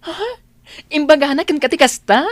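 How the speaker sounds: high, loud gasp of disbelief; shocked disbelief. Acted. Friend hears the good news, shocked and loud.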